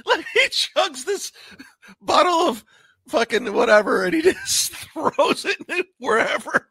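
high words broken by giggles; real giggly retelling; laughing while talking. Real, not acted. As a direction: struggle to explain through laughter. Cracking up describing the clip.

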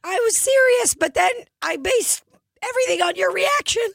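very high squeal; squeaky cartoon reply. Mister Hanky answering Tony, very high squeak. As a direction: chirp it in a tiny cartoon voice.

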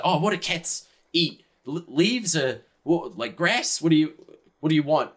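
high, eager, stumbling questions; doting Yuri. Acted. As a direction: fuss over a new pet. Yuri fusses over the cat.

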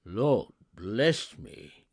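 loud, very slow astonishment; breath taken away. A narrator's reading. Gentleman stunned by Scrooge's donation, astounded. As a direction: exclaim as if robbed of breath. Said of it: "as if his breath were taken away".

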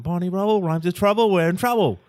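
high, quick mock Cockney. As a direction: explain the rhyme in a hammed-up Cockney. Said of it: "doing that rhyming Cockney slang".

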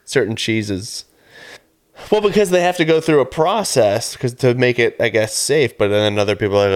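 ordinary talk

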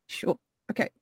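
whispered, resigned agreement; deadpan whisper. Retold or imitated. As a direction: mutter it, giving up. Deadpan reaction to a rude name.

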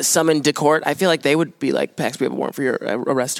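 ordinary delivery

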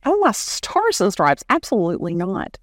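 high exclamation, then firm no; playfully horrified anyone would forget; woman reassures a boy she never forgot his cake; mock-shocked denial. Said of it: "I'm like".

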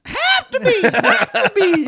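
squeaky falsetto have to be; tiny high-pitched squeak of a voice; really high. Retold or imitated. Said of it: "That was a really high have to be".

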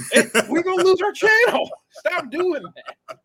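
high, loud pleading; exasperated yell. Real, not acted. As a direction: beg him to stop, laughing. Pleading to stop.

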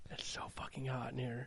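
voiceless, very soft whisper; whispered aside. Real, not acted. Co-host complaining about the heat, whispered aside. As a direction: whisper it under your breath, barely audible.

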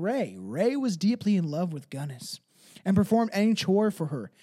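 normal talking voice

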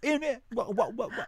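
high, loud wah wah mockery of an English chef; mocking English chef. Mocking the English chef's accent, high and loud. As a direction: squawk wah wah like the chef talking. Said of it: "That's what he sounded like".

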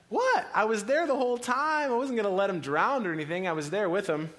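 high, loud startled protest; a caught husband defending himself; indignant defense. Retold or imitated. Husband protests to his wife, high and indignant.

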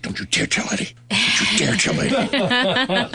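slowing, rising mock warning; Bob's warning. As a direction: imitate Bob hissing a warning. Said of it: "Bob turned to me and he said".

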